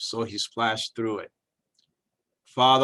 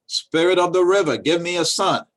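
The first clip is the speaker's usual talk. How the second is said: raised, higher call to the river; 6 semitones higher, 6 dB louder than usual. Acted. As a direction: call out loud across the water. Woman calls to river spirit.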